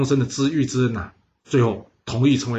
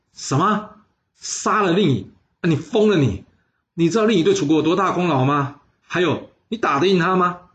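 higher, startled outcry of disbelief; shocked disbelief; 5 semitones higher than usual. A narrator's reading. 白善 hears the plan to kill the premier, shocked. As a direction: recoil as if he's lost his mind.

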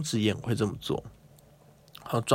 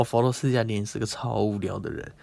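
usual speaking voice

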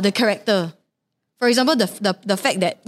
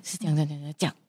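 very soft, low confidential explaining; imitated whisper; 6 semitones lower, 10 dB quieter, much faster than usual. Partner quietly explaining the situation at an event, mock whisper. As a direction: drop right down as if secretly explaining. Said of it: "you will whisper to me".

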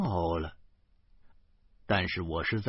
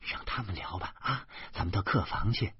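soothing hush; 3 semitones lower, 6 dB quieter than usual. A narrator's reading. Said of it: "立刻揪着严明说".

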